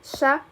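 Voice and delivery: "sha" sounded out alone after a pause; isolated SH sound. Shown as a demonstration. Teacher naming the sha sound for learners, isolated SH sound. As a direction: give "sha" as one short separate sound. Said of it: "This is".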